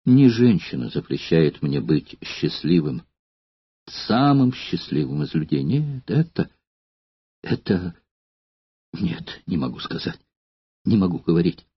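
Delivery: low, hushed, faltering man's voice; struggling to get the words out; anguished Spartacus. A narrator's reading. (Спартак, сдавленно и тихо)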